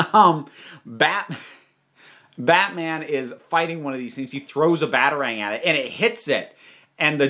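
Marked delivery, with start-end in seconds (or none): none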